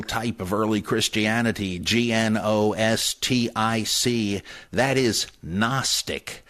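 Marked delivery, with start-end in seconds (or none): 5.4-6.4 "Gnostic" as NOSS-tik, slowly; silent G. Shown as a demonstration. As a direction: drop the G of "Gnostic" entirely. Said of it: "The G is silent".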